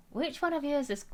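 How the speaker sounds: high, put-on Scottish voice; Scottish accent. Shown as a demonstration. Practising a meme in a Scottish accent. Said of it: "I have a lesser known Scottish accent".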